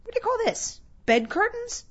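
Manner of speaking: very high, astonished questioning voice; incredulous old Joe. Acted. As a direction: squeak it out in disbelief.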